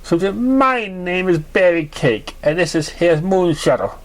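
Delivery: cheerful mare. Acted. Berry Cake voiced.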